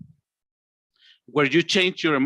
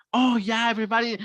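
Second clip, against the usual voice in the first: high, cheery exclamation; acted-out happy excitement; 4 semitones higher, much faster than usual. Shown as a demonstration. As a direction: gush happily in a raised pitch. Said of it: "from happy, you get angry".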